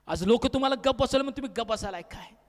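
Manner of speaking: ordinary talk, nothing marked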